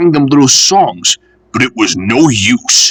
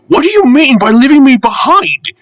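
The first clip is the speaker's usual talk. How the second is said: much higher, louder indignant lady; an affronted refreshment lady demanding answers; 4 dB louder than usual. Acted. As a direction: scold the engine sharply. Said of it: "she demanded".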